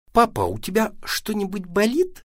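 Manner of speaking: put-on high, sweet child's inquiry; tender. A narrator's reading. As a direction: ask lovingly in a little high voice. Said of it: "ласково поинтересовался Вася".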